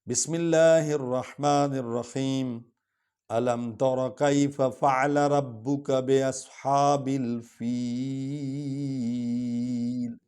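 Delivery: slow, melodic chanted recitation; Quran recitation. Acted. Teacher recites the full surah for students. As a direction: chant the whole surah slowly, devotionally.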